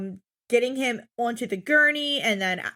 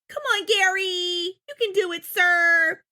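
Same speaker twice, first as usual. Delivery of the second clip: imitating a cheer; 7 semitones higher than usual. Mocking Doctor Now pep talk.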